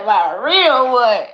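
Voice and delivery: loud, high, laughing call of her future man; imagined man calling her. Retold or imitated. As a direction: boom out his welcome through laughter. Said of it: "He gonna be like".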